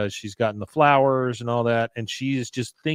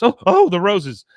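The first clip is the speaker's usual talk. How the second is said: high, loud mock alarm; sudden panic; 8 semitones higher, 10 dB louder than usual. Woman remembers the roses as wife arrives, loud high panic. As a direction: blurt it as if suddenly remembering, pitched up.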